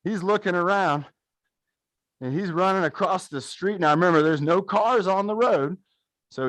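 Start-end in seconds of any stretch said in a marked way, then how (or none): none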